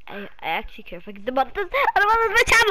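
fast, rising into a high mock-panicked whine. Gamer afraid to lose the channel, mock panic. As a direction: rush it and climb into pretend panic.

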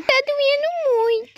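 high, slow wail of pain; acted crying. Boy tells mother his finger hurts, crying. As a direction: whine tearfully that it hurts. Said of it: "filho, não chora".

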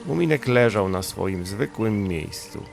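plain reading voice

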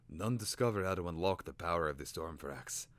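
ordinary delivery, nothing marked

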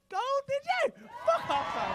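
loud, high disbelieving shout; amazed disbelief. Real, not acted. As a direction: gasp in awe at the gold. Amazed at the gold medal.

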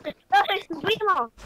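indignant yell. Real, not acted. Child corrects the teasing.

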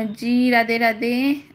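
ordinary talk, nothing marked

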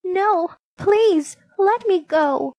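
high, urgent plea; frightened storybook pleading, broken into short bursts; begging. A narrator's reading. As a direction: beg for release, voice pitched right up. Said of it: "she begged".

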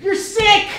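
excited exclaim. Acted. Doctor scene, exclaiming.

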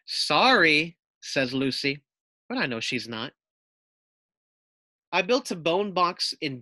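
lively picture-book narration for a class; reading to children. A narrator's reading. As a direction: read it out as if to young pupils. Teacher reads picture book aloud.

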